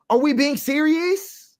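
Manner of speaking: slow, high disbelief; incredulous. Real, not acted. Disbelieving the community talk, slow and high. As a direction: ask it slowly, not believing it. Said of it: "incredulous repeat".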